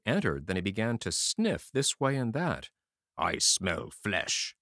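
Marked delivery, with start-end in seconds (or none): 3.2-4.6 low, slow menacing growl; ogre voice. A narrator's reading. Ogre sniffing around his room, low and menacing. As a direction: sniff out the prey, deep and slow.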